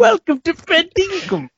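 high, slow mock British welcome; mock British manor accent. Player joking on a manor house, grand mock British accent. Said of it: "like the name of a manor house".

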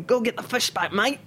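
Kiwi boss calling. Retold or imitated. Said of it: "remember Al saying".

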